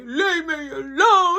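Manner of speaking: high, slow mock wail; [name] mimicking the crying brother; mocking crying kid. Brother teasing the crying kid, mock wail. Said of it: "So [name] like".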